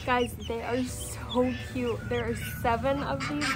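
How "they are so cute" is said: ordinary talk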